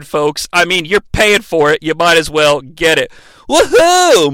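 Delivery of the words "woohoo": mock whoop.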